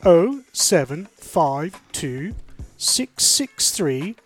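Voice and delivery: slow, spaced-out digits; slowly. Real, not acted. Host giving listeners the text number, slowly. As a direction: read the number slowly, pausing between groups. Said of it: "I'm gonna say this slowly".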